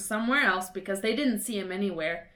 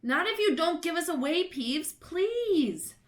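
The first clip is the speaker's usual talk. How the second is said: higher, hurried begging; pleading; 8 semitones higher than usual. A narrator's reading. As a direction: plead with the poltergeist. Begging Peeves.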